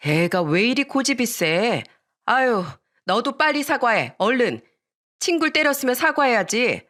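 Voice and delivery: tutting mother's reproach; disapproving tut-tutting. A narrator's reading. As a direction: scold someone else's stubborn child. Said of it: "혀를 차며 말씀하셨어요".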